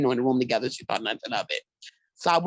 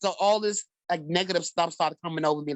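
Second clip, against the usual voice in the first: usual speaking voice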